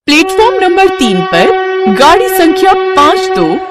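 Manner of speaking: high-pitched railway announcer delivery; station announcement. Acted. Announcer tells passengers trains are running again, formal announcement. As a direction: address passengers like a platform announcer. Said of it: "अचानक एक announcement".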